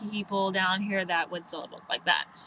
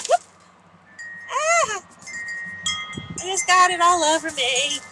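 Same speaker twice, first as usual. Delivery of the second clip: high startled yelp, then a louder, higher complaint; startled cry; 9 semitones higher, 3 dB louder than usual. Real, not acted. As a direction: jump at the mess on you. Spilled something on herself.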